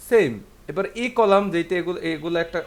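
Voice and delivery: ordinary talk, nothing marked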